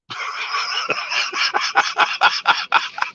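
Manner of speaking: long run of rapid chuckles; real, unvoiced staccato laugh; laughter. Real, not acted. Streamer laughing at his own story, amused. Laughs after retold exchange.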